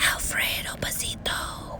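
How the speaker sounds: hushed, voiceless stage whisper. A narrator's reading. Crowd whispering the bandit's name, hushed whisper. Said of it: "whispered the crowd".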